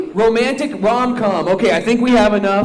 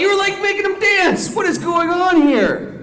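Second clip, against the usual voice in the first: exasperated shouting; 11 semitones higher than usual. Acted. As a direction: shout at the actors in disbelief. Director furious at Cupid.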